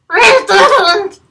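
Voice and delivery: high, loud squeaky bat. Acted. Sleepy bat telling Bernard they couldn't sleep, high and loud. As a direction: squeak it back, annoyed. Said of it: "replied a bat".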